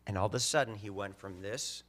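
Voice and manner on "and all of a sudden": ordinary delivery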